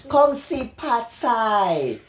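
very slow, high, sing-song Chinese imitation; mock Chinese accent. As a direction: stretch each syllable in a joking Chinese accent. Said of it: "Mga Chinese".

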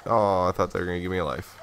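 normal talking voice

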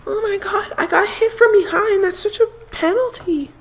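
very high, whiny mock complaint; mocking Tom Brady. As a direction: whimper it like a crybaby quarterback. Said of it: "He's like".

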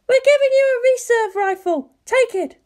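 high, sharp woman's voice; Misato voice. A narrator's reading. Misato scolding Shinji over the link, high and sharp. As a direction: snap at him from the command room.

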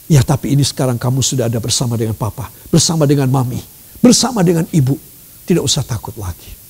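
soft, fast, calming hush; quiet a scared child quickly; soothing father. Acted. Father comforting his child after a nightmare, softly reassuring.

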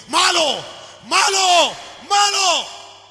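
mock condemning chant, building to a full shout; accusing shout. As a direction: hurl each word harder, like the stone tablets condemning. The law keeps accusing sinners.

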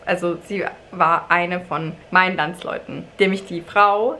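ordinary talk, nothing marked

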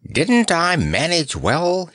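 high, drawn-out, pleased chirping; high-pitched and pleased with yourself; happy chirp. Acted. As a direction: chirp it slowly with pride. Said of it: "he chirped happily".

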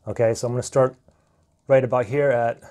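usual speaking voice

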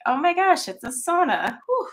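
high, surprised gasp of heat; excited exclamation. Retold or imitated. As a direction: exclaim as if stepping into a sauna. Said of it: "I was like".

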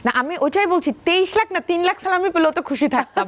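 high, animated insistence; real excited denial of the rumour; excited protest. Real, not acted. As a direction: push back laughingly, pitch way up. Protesting the fake salami news.

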